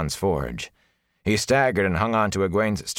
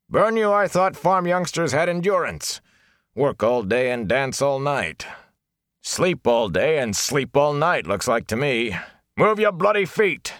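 mocking angry shout; 8 semitones higher than usual. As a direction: jeer at them to move faster. Elias taunting the farm youngsters.

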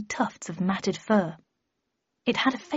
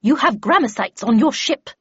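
loud, higher warning; brisk raised-voice announcement; yelled; 4 semitones higher, 6 dB louder than usual. Acted. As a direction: call it up to the guard on deck. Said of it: "yelled Havisham".

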